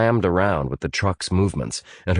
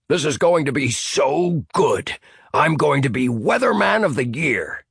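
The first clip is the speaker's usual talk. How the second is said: gloating Sandy; 8 semitones higher than usual. Acted. Sandy voiced by narrator.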